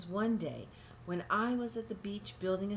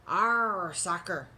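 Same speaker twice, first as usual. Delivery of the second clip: louder gruff pirate cry; pirate yell; 5 dB louder, much slower than usual. Acted. Captain and crew yelling over soccer. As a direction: bellow it while kicking the ball. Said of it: "kicked the ball and yelled".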